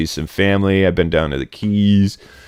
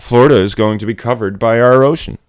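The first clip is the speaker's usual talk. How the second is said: ordinary delivery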